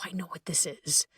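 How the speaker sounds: a hushed whisper of calm recognition; whispered realization. Shown as a demonstration. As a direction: breathe it out as a soft whisper. Calm self-aware inner voice.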